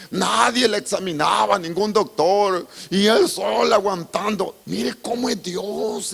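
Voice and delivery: loud, high sermon delivery; fiery preaching. Acted. Preacher telling the congregation Miriam's punishment, loud fiery preaching. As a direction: preach it with full force.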